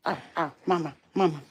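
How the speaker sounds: ordinary talk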